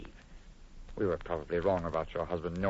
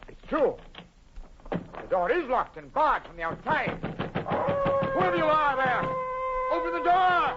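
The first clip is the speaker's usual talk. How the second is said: much higher, louder frantic yelling; panicked shout; 4 dB louder, slower than usual. Acted. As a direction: pound the door and shout. Locked in, shouting at captor.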